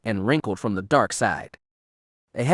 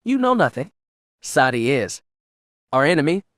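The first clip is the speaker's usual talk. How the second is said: spat; 2 semitones higher than usual. A narrator's reading. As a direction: spit it out with contempt. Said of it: "Kira spat".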